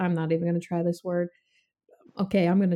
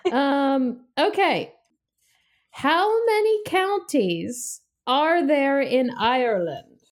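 ordinary delivery

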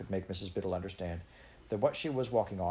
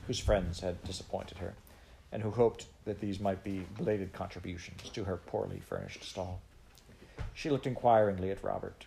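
ordinary delivery, nothing marked

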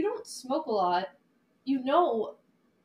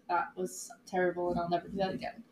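1 quiet regret; 7 semitones lower, 7 dB quieter than usual. Retold or imitated. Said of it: "I would be like".

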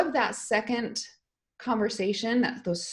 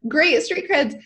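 laughing through the words, slow and higher; 7 semitones higher, 7 dB louder, slower than usual. Real, not acted. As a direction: chuckle it out slowly. Laughs at her own phrase.

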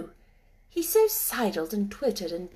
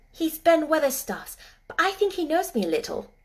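tag; 7 semitones higher, 4 dB louder than usual. A narrator's reading. Mary's answer voiced in story.

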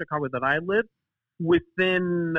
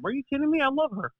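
higher-pitched incredulous insistence; mock indignant protest; 10 semitones higher, much faster than usual. Son defending himself to his friend, mock indignant.

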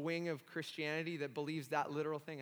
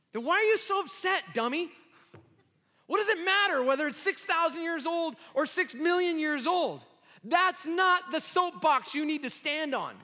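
much higher and louder, exasperated rebuke; loud scolding; 12 semitones higher, 10 dB louder than usual. Retold or imitated. Mentor Harold rebuking angry Sean, loud exasperated scolding. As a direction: shake him out of it, half-teasing.

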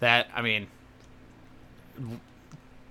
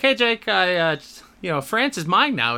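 mock voicemail; 7 semitones higher, 6 dB louder than usual.

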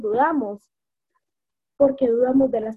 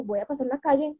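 ordinary talk, nothing marked

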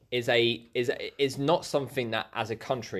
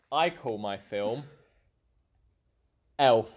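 ordinary talk, nothing marked

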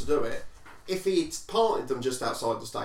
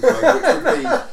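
laughing; 12 dB louder, slower than usual. Real, not acted. Laughing at own joke about forest.

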